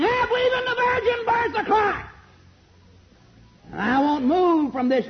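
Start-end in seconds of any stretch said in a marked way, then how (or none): 0.0-2.1 put-on high shouted old-time preacher proclamation; preacher's shout. Preacher imitates an old-fashioned preacher, shouting high. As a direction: belt it like a sweating revival preacher.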